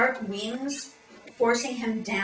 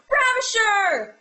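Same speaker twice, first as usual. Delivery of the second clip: drawn-out, high theatrical shout; yell; 10 semitones higher, 7 dB louder, slower than usual. Retold or imitated. Attacker with a knife accusing Covenant, yelling. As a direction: stretch the accusation into a loud yell. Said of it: "He yells".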